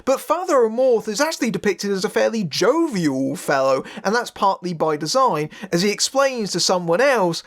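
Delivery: ordinary delivery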